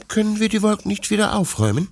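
high, quick hopeful puppy voice; pleading puppy Bruno. A narrator's reading. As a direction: beg quickly like a little puppy. (bittender kleiner Welpe, hoch und schnell)

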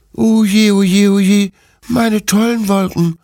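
high, loud lament of a little dog; wailing puppy. Acted. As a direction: wail like a heartbroken pup. Puppy laments his lost clouds.

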